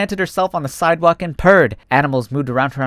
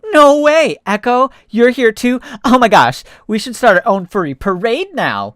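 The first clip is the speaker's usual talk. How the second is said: much higher, louder greeting; thrilled; 8 semitones higher, 5 dB louder than usual. Acted. Fox seeing his friend at the station, thrilled and loud. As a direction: burst with joy.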